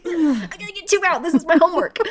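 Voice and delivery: mock determination.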